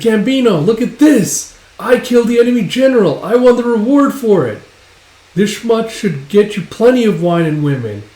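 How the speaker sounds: high, loud triumphant shout; loud boast easing slightly at the end; excited boasting. Acted. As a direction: proudly crow about a kill. Boasting of a battle victory.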